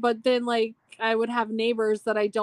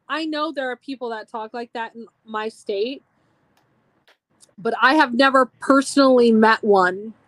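ordinary talk, nothing marked

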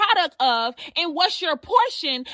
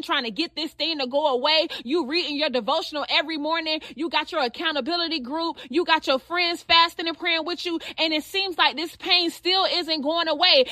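ordinary talk, nothing marked

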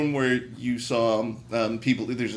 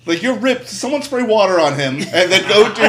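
higher, louder, laughing through it; 7 semitones higher, 8 dB louder than usual. Real, not acted. Mocking the shirtless fight scene, laughing.

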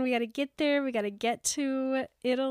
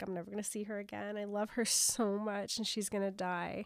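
soft heartbroken voice; 2 semitones lower, 7 dB quieter than usual. Retold or imitated. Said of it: "being like".

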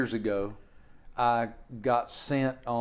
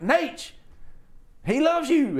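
high, eager pleading voice; excited pitch; 14 semitones higher, slower than usual. Retold or imitated. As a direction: sell it, voice way up. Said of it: "I'm like".

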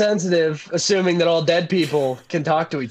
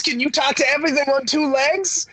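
incredulous; 7 semitones higher than usual. Acted. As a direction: fire back, much higher. Arguing back in character.

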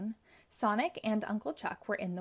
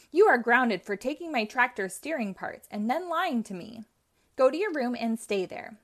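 stern scolding; 3 semitones higher, 7 dB louder than usual. Acted. Uncle Chuck grounds Robotnik.

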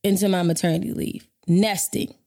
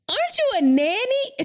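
high-pitched, incredulous question; stunned disbelief. Retold or imitated. Expectant mother to rude client, stunned disbelief. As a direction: retell it as a stunned, high squeal. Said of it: "I was so stuck".

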